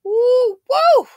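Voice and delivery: high loud whoop; high woo. Real, not acted. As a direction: whoop twice in disbelief. Reacting in disbelief.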